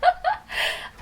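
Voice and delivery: high bright burst of laughter; real laugh. Real, not acted. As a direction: laugh it off, amused. Laughs at being called stubborn.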